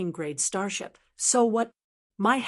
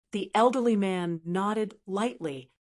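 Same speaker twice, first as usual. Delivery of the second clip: ordinary delivery, nothing marked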